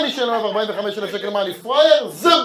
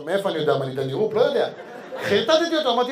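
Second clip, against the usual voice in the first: ordinary delivery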